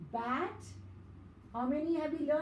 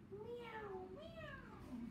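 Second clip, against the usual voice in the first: high, soft mewing meow; cat meow; 9 semitones higher, 15 dB quieter than usual. Shown as a demonstration. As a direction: mew like a little cat for the kids. Teacher imitates cat sound.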